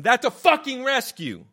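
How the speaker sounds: comic yelling at the hippie; triumphant shout. Acted. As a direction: slam the punchline. Punchline rant.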